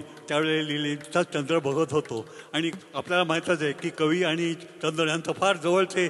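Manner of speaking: normal talking voice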